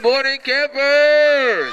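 long, high, loud rally call; camp counselor cheer. Real, not acted. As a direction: belt it slowly to wake up the campers. Co-host repeats the camp greeting.

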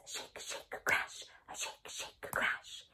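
whispered shake shake crash; whispered tambourine. Shown as a demonstration. Teacher shows kids the tambourine sound in a whisper. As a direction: breathe it like a shaking tambourine. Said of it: "Sounds like this".

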